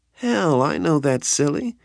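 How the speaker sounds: high, playful brush-off; high teasing voice. Acted. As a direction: tease him brightly. Nikki brushing him off.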